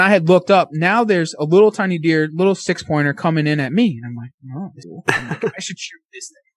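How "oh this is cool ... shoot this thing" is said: near-whispered retold thought; hushed. Hunter eyeing a close little deer, hushed. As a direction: barely voice it, much softer.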